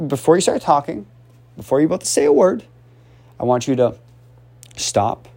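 normal talking voice

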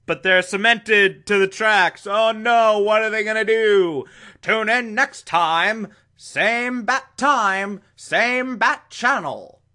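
high, urgent TV announcer parody; hammed-up old serial announcer; mock cliffhanger announcer. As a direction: build suspense, then stretch out the sign-off.

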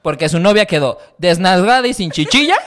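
loud joking rant at the camera; mock outraged call-out. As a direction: call her out, loud and indignant. Calling out Laura Flores on camera.

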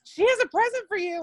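high-pitched imitation of calling across. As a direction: announce it to someone over the street. Acting friend calling out to Bray.

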